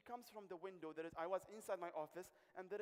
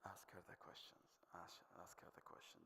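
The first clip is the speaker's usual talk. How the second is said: whispered inner voice, like a voice in his head; 13 semitones lower, 15 dB quieter than usual. Retold or imitated. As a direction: whisper it to yourself, urging. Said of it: "a voice that is saying to me".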